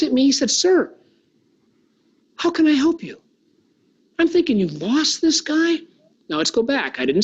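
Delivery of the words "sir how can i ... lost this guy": eager helpful waiter. Retold or imitated. Voicing helpful Jacob brightly.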